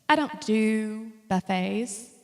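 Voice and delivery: ordinary delivery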